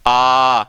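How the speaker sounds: "आ" held long and steady; long vowel sound. Shown as a demonstration. Teacher isolating the vowel for students, long "आ". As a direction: sustain "आ" as an open long vowel. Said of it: "ये जो sound है vowel वाली".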